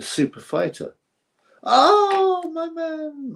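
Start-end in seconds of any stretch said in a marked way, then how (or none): 1.6-3.3 much higher, sudden delighted cry; a squealing, overjoyed oh my man; excited outburst. Real, not acted. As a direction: burst out in sheer delight at your mate.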